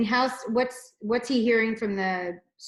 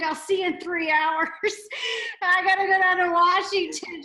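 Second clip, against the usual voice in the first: laughing excitedly; 10 semitones higher, 6 dB louder than usual. Retold or imitated. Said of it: "I'm like".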